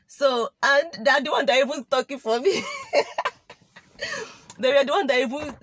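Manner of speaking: words broken by giggles; laughing while telling. Real, not acted. Telling viewers about her admirers, laughing. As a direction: laugh through the line.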